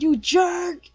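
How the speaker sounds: loud, high-pitched outraged shout; angry yell. Retold or imitated. Hero yelling at the villain from a rock, angry. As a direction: yell it like a furious anime hero.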